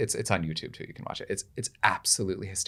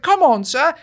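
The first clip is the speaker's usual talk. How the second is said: much higher, louder, slow stagey squeal; eager little page; 9 dB louder, slower than usual. Retold or imitated. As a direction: play the excited king's page. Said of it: "And I was like".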